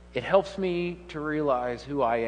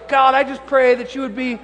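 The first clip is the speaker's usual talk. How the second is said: mock fervent prayer; 6 semitones higher, 6 dB louder than usual. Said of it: "And so".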